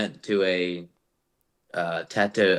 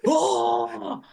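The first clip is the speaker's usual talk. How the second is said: high-pitched drawn-out "oh"; hammed-up high wail; mock shocked moan. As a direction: let out a long, high cry of mock horror. Acted reaction to the cutting.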